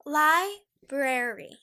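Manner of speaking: "Library" slow and loud with both r's, lie-brer-ee; careful correct pronunciation. Shown as a demonstration. Teacher drilling viewers, slow careful library. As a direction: spell out "Library" with the middle r sounded. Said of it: "I'm gonna say it again for you".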